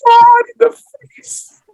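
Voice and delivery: very high, loud mock sobbing wail; hammed-up tearful squeal, drawn out; weepy hysterics. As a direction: imitate a grandma weeping hysterically. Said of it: "this weepy".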